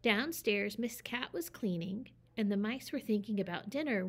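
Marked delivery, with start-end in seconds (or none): none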